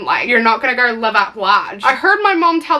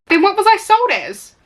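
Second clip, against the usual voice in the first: put-on confused girl; 8 semitones higher than usual. Patricia's confused question voiced.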